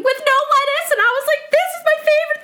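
high squealed delight; excited squeal. Retold or imitated. Retelling his takeout order, high excited squeal.

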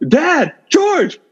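high-pitched mock holler; acted, very high shout after Dad; imitated calling out. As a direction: call after someone as in a movie scene. Retold Marty calling after his dad.

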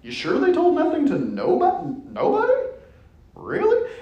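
high, mocking, laughing doubt; rising sarcastic questions, pitch climbing; smart-aleck disbelief. Smart aleck needling the gospel reader, sarcastic and high. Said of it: "The smart aleck in me".

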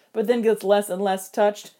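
ordinary delivery

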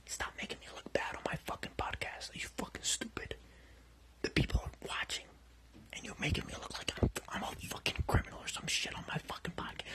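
hushed angry whisper; a furious whisper kept off mic. Real, not acted. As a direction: hiss it so the audience won't hear. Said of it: "Why the fuck are we whispering?".

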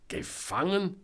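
high questioning echo, rising; incredulous echo. A narrator's reading. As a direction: repeat it as a doubting question. Selma echoes him in disbelief. (ungläubig nachfragend, hoch)

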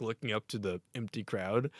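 ordinary talk

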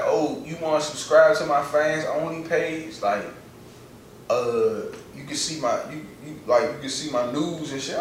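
soft, slow, coy imitation of a girl's sales pitch. Friend mimicking a girl pitching her page in his DMs, soft and coy. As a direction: purr it like she's selling her page.

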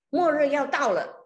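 loud urgent proclamation to the whole train carriage; 大声宣告. Retold or imitated. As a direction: call it out loudly like a street preacher. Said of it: "大声喊说". (在车厢里大声宣告)

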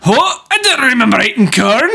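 high, loud hammed-up Scottish accent. Quoting Austin Powers for friend, loud Scottish accent. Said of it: "my stereotypical Scotsman".

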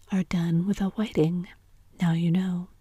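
ordinary delivery, nothing marked

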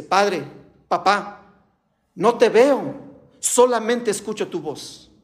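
ordinary talk

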